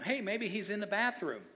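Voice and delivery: mocking. Elijah mocking the prophets of Baal, teasing. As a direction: sneer it like a heckler. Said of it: "He's mocking them".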